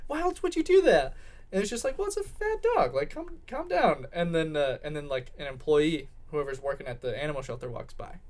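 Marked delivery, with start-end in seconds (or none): 0.1-1.1 high-pitched frantic insisting; insistent girlfriend. Acted. Girl swearing to her boyfriend they're weighing the dog, frantic. 2.0-4.1 high-pitched mock exasperation; exasperated calming. Guy telling his girlfriend to calm down, exasperated.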